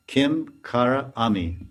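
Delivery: "Kim Kara Ami" slowly, word by word; slow teaching breakdown of "Kim Kara Ami"; broken into words. Shown as a demonstration. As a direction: separate "Kim", "Kara" and "Ami" with small gaps. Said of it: "So Kinkari means Kim".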